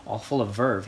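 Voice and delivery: ordinary delivery, nothing marked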